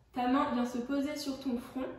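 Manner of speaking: usual speaking voice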